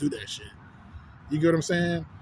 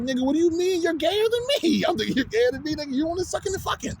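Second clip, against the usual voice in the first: high, far-off mock outraged voice; mocking the homie in a much higher voice; incredulous retort; 12 semitones higher than usual. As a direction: squeal it back in disbelief.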